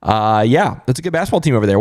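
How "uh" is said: usual speaking voice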